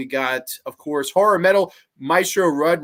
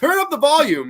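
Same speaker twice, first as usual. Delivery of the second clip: raised, loud dad impersonation; teasing dad; 10 semitones higher, 6 dB louder than usual. Retold or imitated. Dad goading scared kid at horror movie, mock command. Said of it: "My dad was the worst".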